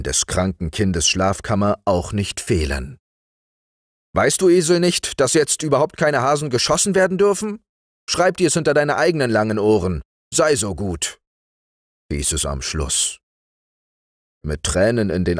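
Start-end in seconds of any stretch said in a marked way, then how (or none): 4.1-11.2 raised, high-pitched telling-off; indignant uncle's rapid dressing-down; stern scolding. A narrator's reading. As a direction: scold him fast and forcefully. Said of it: "an einer tüchtigen Strafpredigt".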